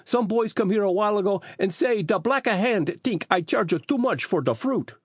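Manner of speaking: put-on high-pitched Italian-accented character voice; Italian fruit seller. A narrator's reading. Fruit seller complains to Phil about the boys, Italian character voice.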